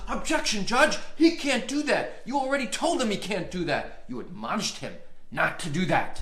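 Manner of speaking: loud, high outraged objection, shouting at the judge; retold angry yell. Opposing attorney jumps up yelling at the judge. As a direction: leap up and yell your objection.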